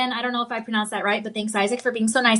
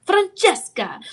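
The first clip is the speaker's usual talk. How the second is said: Francesca in a higher, Italian accent, with a rolled, singing vowel on the end; 7 semitones higher, slower than usual. Shown as a demonstration. Host answers a viewer's request, Italian accent. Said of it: "Say Francesca with the accent".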